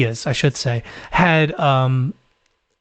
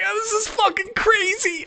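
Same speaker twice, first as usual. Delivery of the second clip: very high, softer stunned gasp; breathless mock disbelief; losing it; 5 dB quieter than usual. Viewer reeling at the gruesome course, high stunned exclaim. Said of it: "I was losing it".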